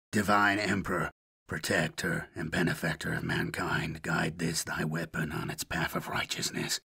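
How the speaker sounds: low, soft murmured prayer to the Emperor; assassin's prayer. Acted. Lone assassin praying to his Emperor, low and hushed. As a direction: pray quietly over the rifle before the shot.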